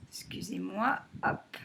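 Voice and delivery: ordinary talk, nothing marked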